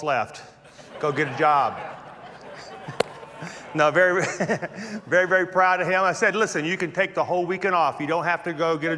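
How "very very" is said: usual speaking voice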